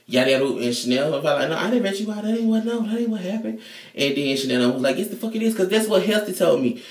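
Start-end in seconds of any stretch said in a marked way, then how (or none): 1.5-3.6 high denial. Retold or imitated. Chanel Five denying, voiced. 5.0-6.8 high accusing voice. Retold or imitated. Chanel One accusing, voiced.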